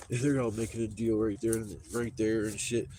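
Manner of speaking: ordinary talk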